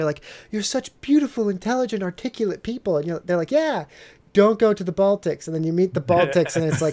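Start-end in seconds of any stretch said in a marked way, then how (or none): 0.5-3.0 gushing tourist. Retold or imitated. Mocking gushing praise of Nordics. 3.5-5.5 mock Nordic warning. Nordic people's warning, voiced.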